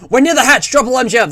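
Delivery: high, loud American announcer; hammed-up Cold War American voice; American accent. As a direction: call it out like an American drill instructor. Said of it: "like, an American voice".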